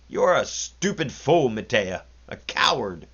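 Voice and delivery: high, loud, slow insult. A narrator's reading. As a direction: spit the insult at him. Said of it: "she began to insult me".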